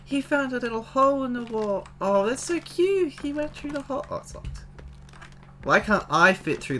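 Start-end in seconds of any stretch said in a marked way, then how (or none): 0.1-4.1 high-pitched gushing over something cute; cooing delight. Real, not acted. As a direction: coo at a tiny animal, pitched way up. Delighted by a cute little mouse.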